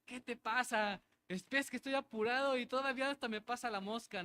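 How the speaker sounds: preacher imitating himself scolding a fly, mock irritated; mock annoyed outburst. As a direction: snap at the fly in playful annoyance. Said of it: "y yo así".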